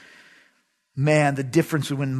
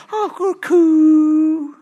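put-on baby talk; 10 semitones higher, 4 dB louder, much slower than usual. Dad cooing at baby.

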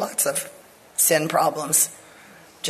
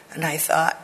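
ordinary talk

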